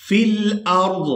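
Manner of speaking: slow, melodic tilawat, drawn out; Quran recitation. Shown as a demonstration. Teacher reciting the verse to students, slow sung tilawat. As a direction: chant each word long and sung.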